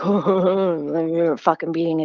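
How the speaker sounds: slow, mock gruff cop voice; imitating the cop. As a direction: drag it out like a sneering officer. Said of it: "He's like".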